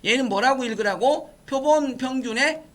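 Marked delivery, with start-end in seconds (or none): 1.5-2.6 higher, deliberate recitation; teacher's careful read-along; read-aloud model. Shown as a demonstration. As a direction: lead students through the term clearly. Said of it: "이렇게 읽으시라고".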